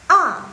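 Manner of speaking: A thrown down sharply from high pitch; loud, short falling A; fourth tone. Shown as a demonstration. Teacher repeats tone four for students, emphatic falling A. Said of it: "Yang keempat".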